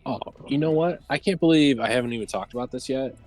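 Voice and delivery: normal talking voice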